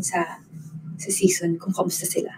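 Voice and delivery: soft teasing whisper. Real, not acted. Hinting to viewers about the new teammates, whispered. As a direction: drop to a secretive whisper.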